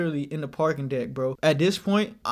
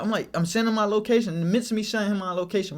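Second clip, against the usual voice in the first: ordinary delivery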